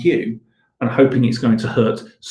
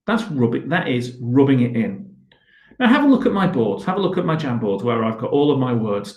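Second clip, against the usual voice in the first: ordinary delivery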